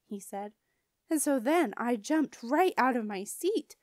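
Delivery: ordinary delivery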